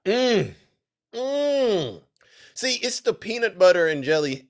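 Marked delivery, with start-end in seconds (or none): 0.1-2.0 high, drawn-out "mm"; long relishing hum, pitched up; savouring hum. Real, not acted. Streamer relishing the clip, high savouring hum.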